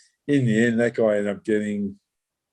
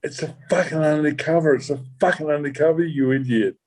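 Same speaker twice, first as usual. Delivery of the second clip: raised, higher mock telling-off; half-acted scolding of a teammate, pitched up; a colleague snaps at the officer, mock exasperated and higher; retold exasperated rebuke; 5 semitones higher than usual. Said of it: "turns around and he goes".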